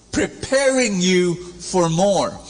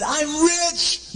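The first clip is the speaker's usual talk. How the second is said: mock delight; 13 semitones higher than usual. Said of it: "he said".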